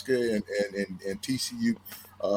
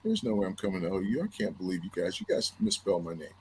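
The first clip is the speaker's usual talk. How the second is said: silent retold resentment; 5 semitones lower, 5 dB quieter, faster than usual. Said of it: "And I'm just glaring".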